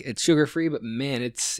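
ordinary delivery

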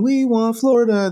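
high, mocking chant of a cocky fan. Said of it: "Leafs fans, like, the fucking".

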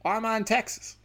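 high-pitched bewildered politician caricature; confused Biden. Acted. Comedian voices a confused president at his house, bewildered high voice. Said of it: "he's just so confused".

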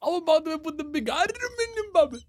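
high-pitched mock Japanese accent; squeaky pseudo-Japanese impression; fake Japanese accent. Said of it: "Das kommt doch alles von dir".

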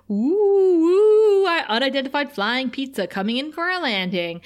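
high, loud wavering "ooh", then near-normal voice; playful spooky flying-object act; mock flying-saucer noise. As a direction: swoop high on the oohs like a UFO. Acting out playful pizza flying gag.

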